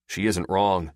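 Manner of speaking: usual speaking voice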